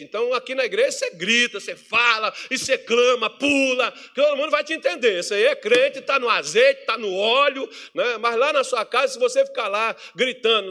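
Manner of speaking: high, loud, driving preaching; pregação inflamada. Real, not acted. Preaches at raised force. (pregação enérgica, em voz alta)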